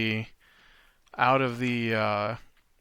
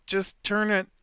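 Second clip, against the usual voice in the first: lightly voiced southern accent, higher; 6 semitones higher, 3 dB louder than usual. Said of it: "suddenly got a southern accent".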